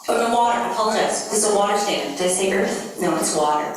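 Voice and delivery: usual speaking voice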